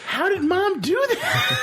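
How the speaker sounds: put-on very high, slow, laughing kid shout; Jake voice. Host as Jake questioning his dad, high laughing kid shout. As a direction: wail it like a baffled little boy. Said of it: "you be Jake".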